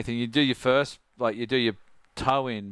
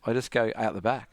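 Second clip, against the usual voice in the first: usual speaking voice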